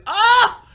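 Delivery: high, loud yelp of pain; short, hammed-up shriek; mock pain scream. Imitates reacting to painful jolt.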